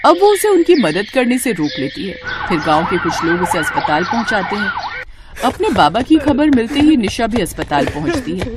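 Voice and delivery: plain reading voice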